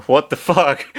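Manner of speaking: ordinary delivery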